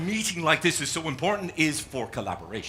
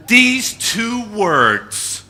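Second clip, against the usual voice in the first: each word drawn out, higher and louder; slow emphasis; 9 semitones higher, 11 dB louder, much slower than usual. Shown as a demonstration. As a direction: lean hard on every word, very slowly. Slow emphatic setup for wordplay.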